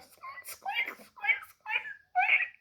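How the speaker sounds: very high squeaky mouse; tiny mouse squeaking at the duck; mouse squeaks. Acted. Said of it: "said Mouse".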